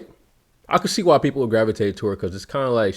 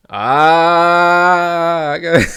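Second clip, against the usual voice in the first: excited outburst; 6 semitones higher, 9 dB louder, much slower than usual. Real, not acted. Cracking up at the clip.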